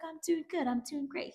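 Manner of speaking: high-pitched put-on chipper voice; squeaky caricature of pleasantries; mock polite reply. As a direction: imitate small talk way up high. Acts out McKay's polite reply.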